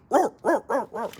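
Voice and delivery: loud, high-pitched dog barks; barking dog imitation. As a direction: bark like a little alarm dog. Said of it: "it's a barking dog".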